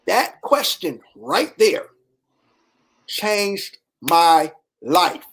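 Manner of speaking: drawn out slowly, each word weighed; slow emphasis. Real, not acted. Telling the audience the question changed his life, slowly. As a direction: let it land slowly.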